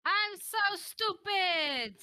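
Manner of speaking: high, loud self-scolding shout; frustrated yell. Real, not acted. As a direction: yell it, angry at yourself. Frustrated gamer yells at herself.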